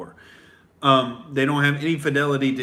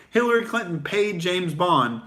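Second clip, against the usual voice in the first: ordinary talk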